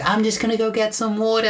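put-on high-pitched little child character; small, high baby voice; little baby cask. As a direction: pipe up as a tiny cartoon kid. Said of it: "the little baby Cask is like".

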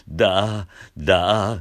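loud creaky だ scooped up; edge voice. Shown as a demonstration. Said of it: "下からしゃくって".

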